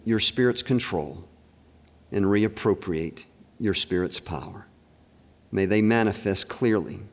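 low, soft closing prayer to God. Acted. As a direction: pray it quietly, fading toward the amen.

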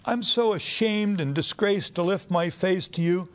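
high, ashamed plea to God; pleading. Acted. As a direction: beg with a lifted, breaking voice. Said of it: "he starts pleading with God".

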